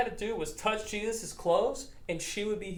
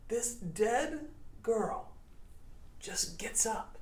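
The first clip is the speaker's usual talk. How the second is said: slow, softer, breathy telling; hushed reveal; 3 semitones higher, 6 dB quieter, much slower than usual. Shown as a demonstration. Preacher telling kids the dead girl rises, hushed. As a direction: let it land in a near-whisper. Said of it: "dramatic reveal".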